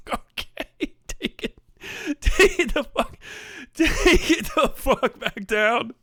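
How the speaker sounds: wheezing laugh breaking into drawn-out words. Acted. Telling the actor to take the list back, cracking up. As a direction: struggle to get the order out through laughter. Laughing through acted rejection.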